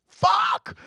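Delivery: sudden loud, high shout of fuck; gleeful shouted curse; shouted swear. Real, not acted. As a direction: blurt it out at full volume. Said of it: "I am allowed to swear, right?".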